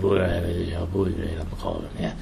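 low, soft voice kept in the throat; Matthew McConaughey throaty mumble. Retold or imitated. Host demonstrating the star's habit of talking in his throat, low and soft. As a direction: talk down in your throat, barely projecting.

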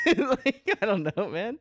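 high, laughing through the words. Real, not acted. Comic laughing at own remark about being Italian, laughing. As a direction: talk through a real laugh.

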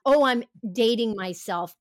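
usual speaking voice

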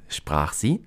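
usual speaking voice

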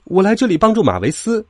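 self-important reply; proud. A narrator's reading. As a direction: state it proudly, as a keen little engine. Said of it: "托马斯骄傲地说".

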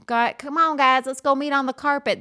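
lightly voiced eager kids calling out; a hint of a child rounding up friends; quoting kids. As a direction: call the class over like a kid. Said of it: "they always call it the rug".

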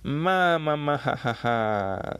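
hammed-up cackle, starting high and falling; mock villain cackle. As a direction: belt out a theatrical evil laugh. Big Mom's laugh acted out.

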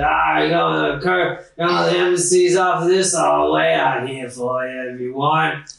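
put-on rambling, slurred old coachman; spluttering coach driver. Coach driver offering to wait outside, spluttering and slurred. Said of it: "coughs and splutters and he said".

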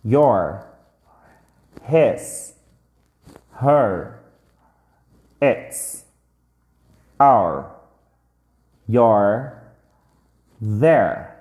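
each word said alone, with a pause after it; slow teacher drill, word by word; drilled word list. Shown as a demonstration. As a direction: model every word for the class to repeat.

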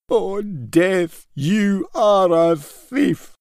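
high, slow, rising lament; grieving wail. A narrator's reading. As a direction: cry out to death, hammed-up grief. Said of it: "he wailed".

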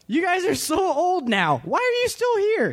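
high, sharp irritated snap; snapped. A narrator's reading. Papa Bear snapping at his adult kids, irritated. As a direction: snap at them, fed up. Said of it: "he snapped".

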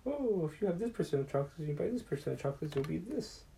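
high, put-on teacher voice explaining chocolates; mock a math teacher in a high voice. Teacher explaining chocolate percentages to the class, in a high mock voice.

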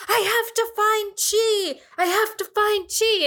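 hammed-up desperate panic; mock freak-out. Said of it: "freaked out and is all like".

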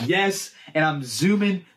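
ordinary talk

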